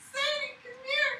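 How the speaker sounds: high, sing-song call; calling the dog. Real, not acted. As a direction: call her over.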